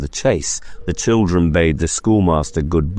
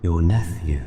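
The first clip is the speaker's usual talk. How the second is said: ghost's voice; 3 dB quieter than usual. Acted. Ghost speaks.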